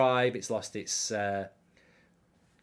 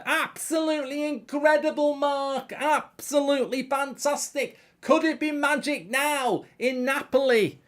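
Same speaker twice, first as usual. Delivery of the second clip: much higher put-on voice, gushing praise; gushing singer voice; 11 semitones higher than usual. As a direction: lay on the gushing like a pop star. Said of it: "another impression for you".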